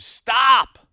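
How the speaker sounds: high, loud scream of "Stop!"; the devil shrieking with his hands over his ears; Satan screams. Acted. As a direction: scream it at the top of your voice. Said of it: "and he screamed".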